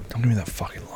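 whispered, mock-stern; hushed scolding. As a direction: whisper it as a private reproach. Hushed aside during partner's teasing.

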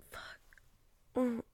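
normal talking voice

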